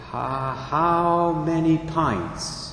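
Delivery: slow, hushed, halting disbelief; a broken half-whisper of dread; stunned, choked question. Acted. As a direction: barely get the words out, stunned. Father learns blood needed.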